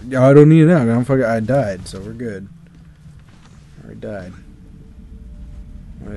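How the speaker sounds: ordinary delivery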